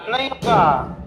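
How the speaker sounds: usual speaking voice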